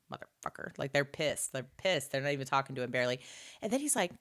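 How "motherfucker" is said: whispered curse under the breath. Retold or imitated. Angry roommates cursing him, whispered. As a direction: mutter it so he won't hear. Pissed guys muttering.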